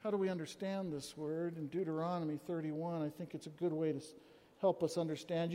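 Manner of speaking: low, soft reading voice; quiet scripture reading. Acted. Preacher reading Deuteronomy to the church, low and soft. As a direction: read it gently and gravely.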